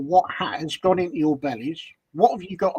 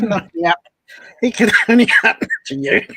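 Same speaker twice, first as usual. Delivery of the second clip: laughing; 5 semitones higher, 8 dB louder, faster than usual. Real, not acted. Teasing co-host loudly.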